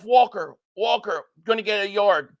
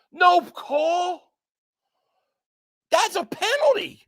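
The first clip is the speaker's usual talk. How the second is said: high, sharp protest at the referees; outraged protest; 7 semitones higher, slower than usual. Real, not acted. Fan protesting the no-call to viewers, outraged. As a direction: yell at the refs for missing it.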